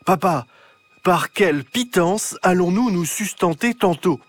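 put-on high, loud old-fashioned demand. Clara calling loudly to papa in archaic French, hungry. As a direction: call out grandly in old words. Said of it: "lui dit d'une voix très forte".

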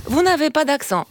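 ordinary delivery

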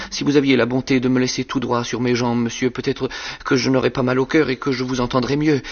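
frightened pleading. Acted. Tilted-back boy begging the convict to set him upright, frightened. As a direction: beg in a small scared boy voice.

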